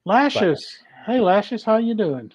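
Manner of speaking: calling out greeting. Real, not acted. Greeting a live chat viewer.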